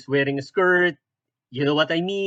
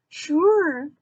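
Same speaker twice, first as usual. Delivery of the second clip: put-on very high squeaky girl voice; Supergirl; 11 semitones higher than usual. Naive Supergirl answers strangers in park, squeaky and sweet. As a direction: naive heroine in a tiny falsetto.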